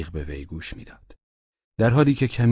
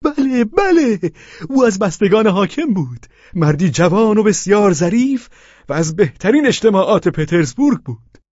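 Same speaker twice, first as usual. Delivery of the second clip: excited cry; 14 semitones higher, 8 dB louder than usual. Acted. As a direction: burst out with eager passion. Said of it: "با شور و التهابی حقیقی فریاد کشید".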